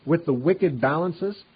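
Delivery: scripture reading. Acted.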